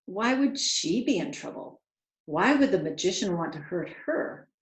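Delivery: ordinary delivery, nothing marked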